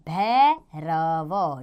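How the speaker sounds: भैरव said very slowly, stretched out; slow demo word. Shown as a demonstration. Teacher slowly pronouncing भैरव for children. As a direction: sound out भैरव slowly for pupils. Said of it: "इस तरह ये भैरव शब्द बन गया।".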